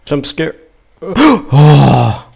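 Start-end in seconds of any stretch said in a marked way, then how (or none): 1.0-2.3 sharp high gasp then loud cry; frightened gasp. Real, not acted. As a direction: jump out of your skin. Jump scare reaction.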